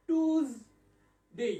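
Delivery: টিউজডে without the y, "tooz-day"; very slow "tooz ... day" for টিউজডে; other pronunciation. Shown as a demonstration. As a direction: draw out টিউজডে as two separate beats, "tooz, day". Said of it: "আরেকটা উচ্চারণ আছে".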